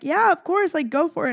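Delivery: high-pitched, eager go-ahead; mock encouragement. Friends urging her to start a podcast, eager encouragement.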